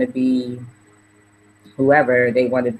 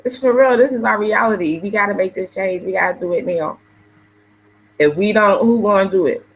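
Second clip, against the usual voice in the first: higher, tearful and urgent; emotional plea; 8 semitones higher than usual. Real, not acted. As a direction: hold back tears while pressing the point home. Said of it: "I told y'all I'm getting emotional".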